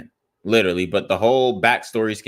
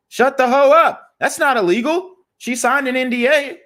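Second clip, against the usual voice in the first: much higher, put-on dude-bro voice; high mocking voice parodying a Trump fan's excuses; 11 semitones higher than usual. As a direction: parody a fan defending Trump, pitched way up. Mock voice of a Trump fan.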